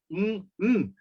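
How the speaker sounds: Mm, mm hummed alone with no vowel; isolated nasal sounds. Shown as a demonstration. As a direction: sound each Mm as a separate nasal hum. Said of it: "Ambas son nasales".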